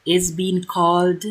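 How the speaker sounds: grand announcer narration; announcer voiceover. A narrator's reading. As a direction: announce the feast like a host.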